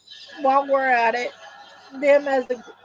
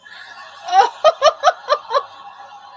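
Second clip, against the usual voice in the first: loud, high belly laugh; real burst of laughter; 11 semitones higher, 5 dB louder than usual. Real, not acted. Laughing at the show's scene.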